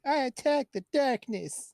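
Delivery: high, slow and grand; mock heroic declaration. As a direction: declare it like a proud hero. Dungeons and Dragons meme quote.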